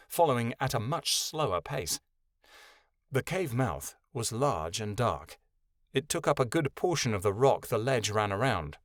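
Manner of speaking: ordinary delivery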